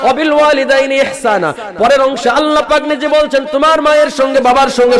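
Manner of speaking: high, loud, chanted appeal; full-force preaching. Acted. Preacher pleading with the young men, high and loud. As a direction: cry out to the young men in a sung pitch.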